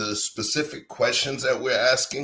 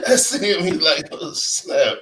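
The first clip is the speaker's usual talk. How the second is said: laughing; 6 semitones higher, 6 dB louder, slower than usual. Real, not acted. Laughing while retelling.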